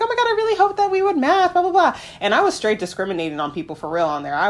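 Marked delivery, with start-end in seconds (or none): 0.0-1.9 high, fast excited gushing; eager gushing. Retold or imitated. Match asking to meet her, quoted high and gushing. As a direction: gush like an overeager new friend.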